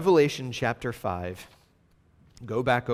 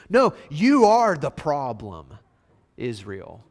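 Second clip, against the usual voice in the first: mock divine rebuke; 7 dB louder than usual. Acting out God's rebuke.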